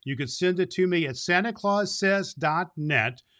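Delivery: ordinary delivery, nothing marked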